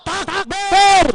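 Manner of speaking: loud, high takbir cry; rallying shout. Real, not acted. Orator calls the rally to answer with takbir, rallying shout. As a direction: shout it out to rouse the whole crowd.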